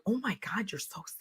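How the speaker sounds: whispered wonder at soft skin. Retold or imitated. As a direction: breathe it out like a hushed discovery. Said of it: "they're like".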